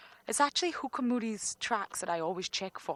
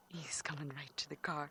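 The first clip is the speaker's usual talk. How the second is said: wildlife watching whisper; 3 semitones lower, 8 dB quieter than usual. Real, not acted. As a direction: whisper so you don't disturb it. Leopard approaching car.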